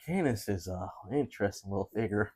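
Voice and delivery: ordinary talk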